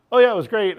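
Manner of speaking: high, loud mock gushing. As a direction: imitate the ex raving about it. Ex gushing about her date.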